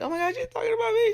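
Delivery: high, fast squeal of a startled client; hammed-up shocked voice; mock panicked client. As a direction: squeak it out like someone caught off guard. Imagined client reacting, put-on voice.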